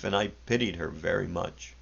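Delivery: ordinary delivery, nothing marked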